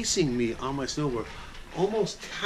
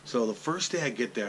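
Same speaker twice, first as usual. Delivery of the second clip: ordinary delivery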